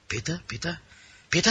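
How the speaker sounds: soft, questioning repeats; imitated confusion, hushed and rising; baffled echo. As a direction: puzzle over the name, very softly. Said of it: "they couldn't understand a word".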